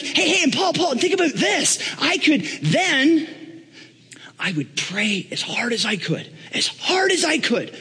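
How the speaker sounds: very high, breathless-to-impress boasting; excited Donald Trump. Acted. Apprentice boasting to Paul of prayer and fame, excited. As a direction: play a wildly eager apprentice, hammed up. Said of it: "Donald gets all excited".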